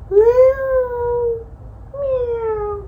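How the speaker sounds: high playful meow; cat meow. Retold or imitated. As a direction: meow like a kitty for a toddler. Cat sound for toddler.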